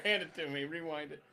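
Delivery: ordinary delivery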